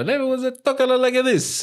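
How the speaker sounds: high, hammed-up New York Italian voice; mock an old-world Italian ad lady; thick New York Italian accent, put on. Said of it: "blatant New York Italian accent".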